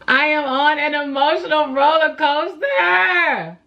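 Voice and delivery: high, slow, loud, half-laughing cry-shout; mock dramatic wail. Wailing to the camera about her emotional week. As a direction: belt it out like a melodrama. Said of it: "repeated louder".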